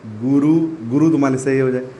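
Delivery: normal talking voice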